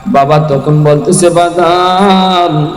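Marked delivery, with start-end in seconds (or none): 1.3-2.7 a long, drawn-out fond call; old father calling. Acted. Old father calling his grown son, drawn-out and fond. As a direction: stretch the word out like a frail old father.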